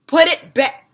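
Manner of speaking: loud, high barked command; sharp mom order. Retold or imitated. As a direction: snap the order at the girls.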